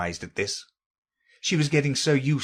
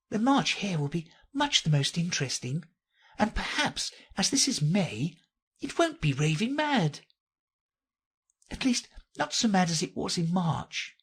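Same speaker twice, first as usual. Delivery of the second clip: Alice musing; 8 semitones higher than usual. Acted. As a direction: muse aloud in a light, high voice. Alice talking to herself.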